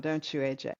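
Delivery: usual speaking voice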